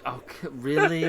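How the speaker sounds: loud, high incredulous reaction; mock disbelief. Character reacts to a jab from Draenei, loud high disbelief. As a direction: jump up in pitch and volume, taken aback.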